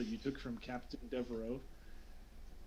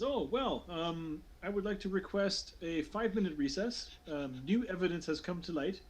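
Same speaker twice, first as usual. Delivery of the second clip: higher-pitched, hesitant formal request; formal official voice, pitched up; counselor addressing council; 4 semitones higher than usual. Acted. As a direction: play a counselor politely stalling the session. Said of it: "He says".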